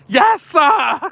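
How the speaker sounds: excited yes sir shout; triumphant cheer. Real, not acted. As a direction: celebrate getting the name right at last. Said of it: "I got it right? Finally?".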